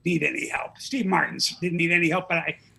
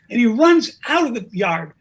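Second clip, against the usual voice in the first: ordinary delivery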